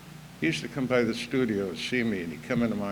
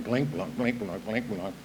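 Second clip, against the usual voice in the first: imitated creaking chair; 3 dB quieter than usual.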